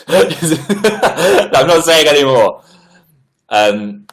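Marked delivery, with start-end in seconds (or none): 0.0-2.6 slow words pushed through real laughter; laughing. Real, not acted. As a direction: give up mid-laugh, pitched higher. Breaks up after attempting French.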